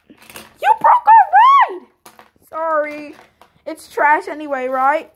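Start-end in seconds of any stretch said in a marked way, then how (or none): 0.1-1.9 high, loud outraged yell; angry shout. Pretend. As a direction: yell it at the culprit. Toy's ride just broken.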